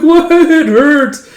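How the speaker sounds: put-on high, loud wail of a hurt kid; hammed-up cry of pain; pained yelp. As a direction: wail it like a kid who got hit. Struck child complains in play battle.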